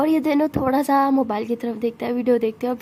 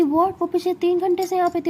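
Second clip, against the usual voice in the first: higher, louder stunned question; higher stunned question to her mother; shocked disbelief; 7 semitones higher, 3 dB louder than usual. Acted. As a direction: hit it with disbelief.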